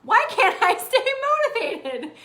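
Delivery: high, loud comic wail; mock despair. Host voicing someone seeing perfect people online, mock despair. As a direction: cry out in exaggerated frustration. Voices envious, frustrated struggler.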